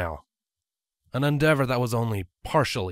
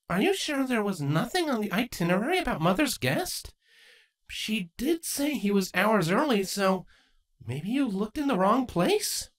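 put-on young mare lifted high and light; Twilight's voice; 11 semitones higher than usual. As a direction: voice Twilight, gently doubtful.

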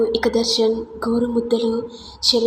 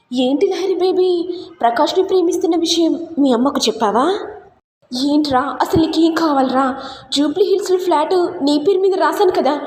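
angry phone retort; 6 semitones higher than usual. Acted. Angry phone call with blackmailer.

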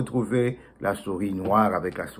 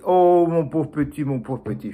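tender mother; 4 semitones higher than usual. Acted. Mother comforts her son.

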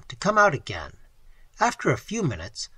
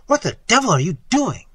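higher, louder burst of disgusted amazement; amazed disgust; 7 semitones higher, 6 dB louder than usual. A narrator's reading. Narrator blurts at Gru in amazement and disgust, impulsively. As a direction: blurt it out, loud and appalled. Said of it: "I said impulsively, in my amazement and disgust".

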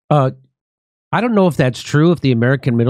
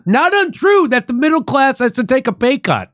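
mimicking the speaker; 9 semitones higher than usual. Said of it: "But she says again".

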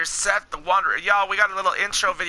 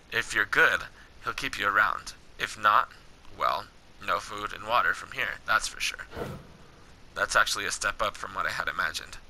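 low, measured game-dialogue read; story dialogue reading; 10 semitones lower, 6 dB quieter than usual. Acted. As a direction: read the wasteland encounter steadily, below your chat pitch. Reads game dialogue aloud.

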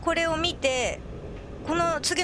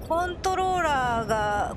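ordinary delivery

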